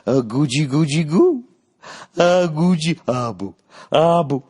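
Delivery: put-on high cooing baby talk; goo-goo cooing under his chin; baby talk to a bald man. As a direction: coo at him like he's an infant. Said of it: "you went".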